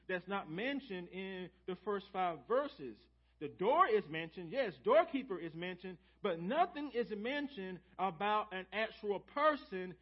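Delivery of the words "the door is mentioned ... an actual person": preaching build. Real, not acted. Preaching climax.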